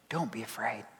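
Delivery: breathy whisper of comfort. Acted. Jesus reassuring John through the pastor, whispered. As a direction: whisper it like a hand on the shoulder.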